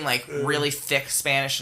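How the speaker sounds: ordinary talk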